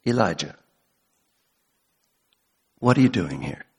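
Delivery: gentle question to a weary prophet. Retold or imitated. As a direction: ask it tenderly, like a caring father. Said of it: "He is saying in a gentle voice".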